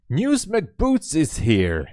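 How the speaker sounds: real delight, stretched and loud; excited greeting. Real, not acted. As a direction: call out the arrival slowly, delighted. Greeting a viewer in chat.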